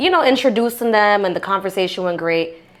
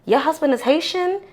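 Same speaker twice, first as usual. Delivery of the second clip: higher, rising question of disbelief; imitated surprise; 7 semitones higher than usual. Haitian women finding out about her husband, mock surprised. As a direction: play someone taken aback.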